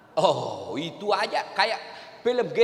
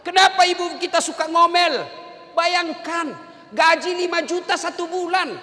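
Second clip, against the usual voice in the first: loud, higher sermon delivery; preaching at full force; 10 semitones higher, 5 dB louder than usual. Acted. Ustaz preaching to husbands about nagging wives, full force. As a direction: preach it out to the whole hall.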